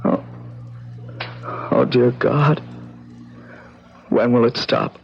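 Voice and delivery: high, distraught, just-woken voice; shaken anguish. Acted. Vincent waking alone from his nightmare, anguished and shaken. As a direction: gasp it out still caught in the nightmare.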